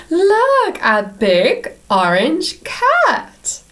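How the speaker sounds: high gasp then a bright high "Look"; mock surprise. Showing the children a big orange cat, surprised. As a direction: gasp and call out in delight.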